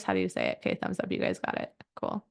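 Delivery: ordinary talk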